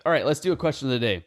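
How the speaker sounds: ordinary talk, nothing marked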